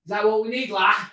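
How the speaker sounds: high, loud Scouse taunt; mock Scouse accent. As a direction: goad him in exaggerated Scouse. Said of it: "in that whiny Scouse accent".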